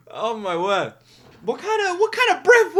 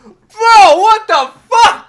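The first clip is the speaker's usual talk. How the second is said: laughing; 5 semitones higher, 6 dB louder, slower than usual. Real, not acted. Laughing reaction to the verse.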